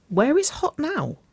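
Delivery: high-pitched inquiry; curious question. A narrator's reading. Sister asks about the date.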